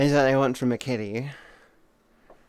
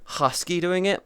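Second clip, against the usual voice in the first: ordinary talk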